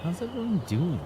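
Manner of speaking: high playful greeting. Pretend. Streamer greeting chat playfully.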